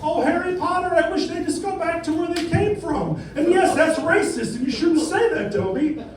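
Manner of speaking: high, squeaky elf voice; Dobby house elf. Acted. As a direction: squeak it out like a fretful little elf. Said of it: "house elf is like".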